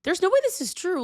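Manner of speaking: disbelieving exclamation. Retold or imitated. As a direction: retell your shock at the rumor, high and loud. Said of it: "I fully was like".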